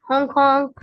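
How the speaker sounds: loud honking truck horn; loud horn imitation. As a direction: honk it out like a truck.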